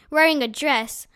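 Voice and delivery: usual speaking voice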